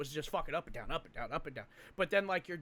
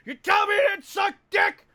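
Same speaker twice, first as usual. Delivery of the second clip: furious high shout; 13 semitones higher, 10 dB louder than usual. Retold or imitated. Mocking Gilcrease screaming at a recruit, shouting. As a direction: yell it right in their face. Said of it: "he'd be like".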